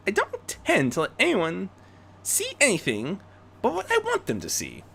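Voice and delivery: prim reply. Acted. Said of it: "replied primally".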